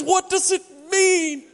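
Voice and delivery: high, slow, laughing awe; double rainbow guy. Retold or imitated. Mimicking the viral rainbow man, high and laughing. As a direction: gasp it out like a man weeping at a rainbow.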